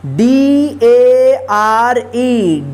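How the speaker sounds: letters spelled one by one, very slow; spelling out letters. Shown as a demonstration. Teacher spelling a word aloud slowly. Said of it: "dare".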